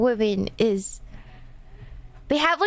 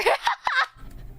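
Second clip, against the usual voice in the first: a high, bright burst of laughter; 13 semitones higher than usual. Real, not acted. Laughs at her own remark.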